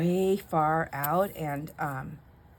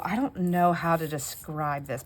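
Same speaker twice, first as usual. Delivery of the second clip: ordinary delivery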